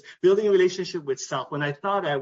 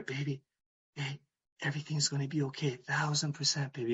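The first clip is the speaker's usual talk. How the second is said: whisper; 2 semitones lower, 8 dB quieter than usual. Retold or imitated. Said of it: "I quietly whispered in her ear".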